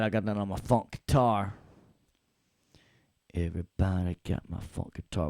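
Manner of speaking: normal talking voice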